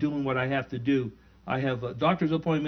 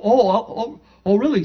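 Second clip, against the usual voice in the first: delighted surprise; 7 semitones higher, 7 dB louder than usual. Retold or imitated. Father hears shared Filipino roots.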